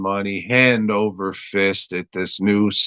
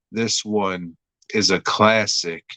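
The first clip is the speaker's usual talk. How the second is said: normal talking voice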